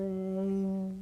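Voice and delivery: ear held long and steady, overtone out; slow harmonic ear. Shown as a demonstration. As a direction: sustain ear slowly between E and er. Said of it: "I'll do it slower".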